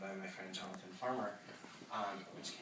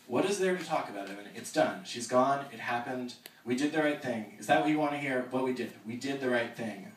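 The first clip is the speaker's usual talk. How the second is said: higher, louder exasperated insistence; heated outburst; 4 semitones higher, 11 dB louder than usual. Acted. Jack defends himself to Evan, heated and loud. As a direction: hammer home we did the right thing.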